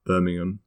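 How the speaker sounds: "Birmingham" ending in an unstressed "um"; H dropped. Shown as a demonstration. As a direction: leave the H out of "Birmingham". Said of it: "we don't say the H".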